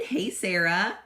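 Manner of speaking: normal talking voice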